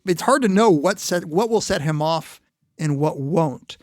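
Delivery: ordinary talk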